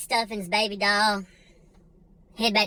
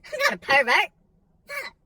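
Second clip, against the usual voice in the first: panicked mutter; 6 semitones higher than usual. Retold or imitated. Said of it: "and I'm like".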